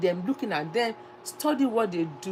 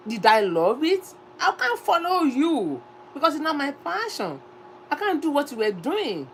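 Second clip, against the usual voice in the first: passionate insistence; 8 semitones higher, 5 dB louder than usual. Real, not acted. Insists passion over money.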